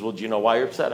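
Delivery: low, soft, lightly imitated sponsor; understated, quiet sponsor voice; sponsor's question. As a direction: repeat the question low and unruffled. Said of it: "He says".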